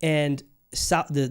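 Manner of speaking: ordinary talk, nothing marked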